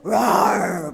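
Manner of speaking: low, loud mock lion roar; a hammed-up lion's roar for the congregation. Shown as a demonstration. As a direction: roar like a lion to show it's only noise. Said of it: "roaring lion lang yan".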